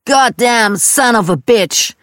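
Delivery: hammed-up furious shout; yelled curse. Dad hitting the stuffed animals, mock angry yell. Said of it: "wie mein Dad schrie".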